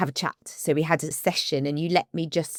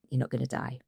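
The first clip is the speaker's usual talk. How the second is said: "You're not gonna die" said lower and softer; "You're not gonna die" murmured lower to herself, calm and settled; soft inner reassurance; 7 semitones lower, 7 dB quieter, much faster than usual. Shown as a demonstration. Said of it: "drop into place and go".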